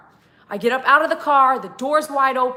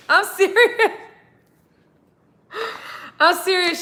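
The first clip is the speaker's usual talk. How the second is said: laughing insistence; 7 semitones higher than usual. Real, not acted. Laughing emphasis.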